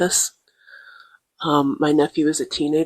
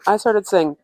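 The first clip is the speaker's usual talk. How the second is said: normal talking voice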